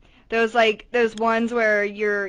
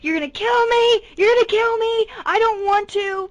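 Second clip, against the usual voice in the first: higher, louder, frantic pleading; panicked child wail; 11 semitones higher, 4 dB louder than usual. Retold or imitated. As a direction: beg not to be put on the coaster. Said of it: "I was bawling my eyes out".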